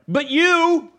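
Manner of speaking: high, loud pointed blame; mock spouse accusing. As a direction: jab the words at the spouse. Spouse quarrel retold.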